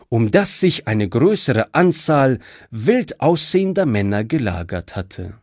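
usual speaking voice

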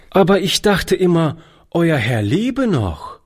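put-on bewildered boy, slowing down; lightly voiced confused child; young boy Toni. A narrator's reading.

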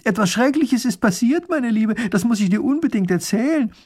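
high, loud, agitated call; urgent calling out. A narrator's reading. Wife shouting from her window to the neighbour, urgent. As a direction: call breaking news across to a neighbour. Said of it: "reißt das Fenster auf und ruft".

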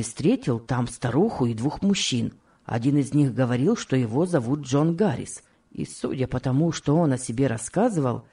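low-pitched man's part; husband explaining. Acted. Husband reports Peter's news to wife, low and explanatory.

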